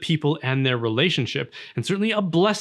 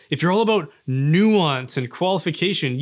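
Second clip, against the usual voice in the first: normal talking voice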